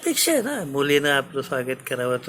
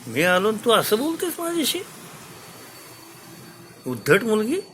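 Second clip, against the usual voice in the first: higher, scolding father; angry king; 8 semitones higher than usual. Acted. King scolds Goneril for her rude reply, angry. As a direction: rebuke the rude daughter from wounded pride. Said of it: "राजाला राग आला".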